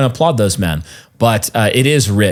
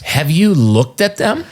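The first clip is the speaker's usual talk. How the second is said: incredulous; 5 semitones higher, slower than usual. Retold or imitated. Mocking the denominations, incredulous.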